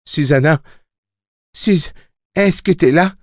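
high voice calling out; appel du garçon. A narrator's reading. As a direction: reach for someone far away, pitched high. Jake calls to Susanna telepathically. (voix haute qui appelle, inquiète de la réponse)

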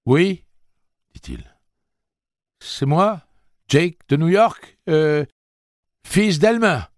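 put-on high, loud, slow boy's call; a boy calling up to speakers. Jake answers the huge voice loudly.

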